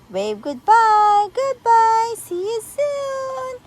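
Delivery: high, slow, stretched farewell; drawn-out playful send-off; sing-song goodbye. Acted. Waving cupcakes off to oven.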